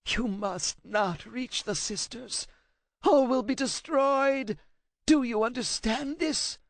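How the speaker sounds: high rasping voice, defiant through fear; a scared scholar standing his ground; frightened translator. A narrator's reading. Translator warns Kratos off the sisters, high frightened rasp.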